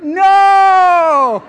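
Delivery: long, high, loud "No!" of dismay; mock anguished shout. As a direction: drag out a big, high theatrical cry of protest. Texted reply acted as a long wail.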